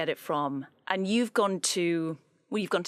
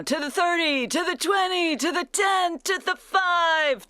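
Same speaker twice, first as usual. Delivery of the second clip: American announcer voice climbing much higher and louder, slowing on touchdown; American commentator accent, building; 8 semitones higher, 5 dB louder, slower than usual. Retold or imitated. Host calling Victor Cruz's touchdown run in a mock American accent. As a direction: build an American play-by-play call to a roaring touchdown. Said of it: "I'm gonna try my American accent".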